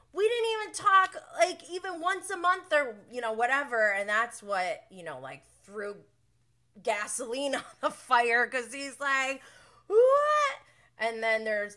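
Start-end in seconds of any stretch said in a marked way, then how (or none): 0.1-3.9 defensive. Retold or imitated. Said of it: "after he flipped the switch". 9.9-10.6 shrieked high, loud "What?"; a squealing cry of disbelief; outraged what. Retold or imitated. James outraged by Tom.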